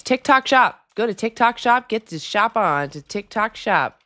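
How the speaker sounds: cheesy influencer plug, poking fun; mock TikTok hype. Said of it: "Do I have to say a bunch of TikTok words?".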